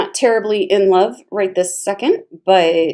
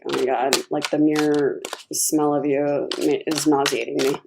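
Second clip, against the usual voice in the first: mock disgust; 6 semitones lower, 4 dB quieter than usual. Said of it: "you're like".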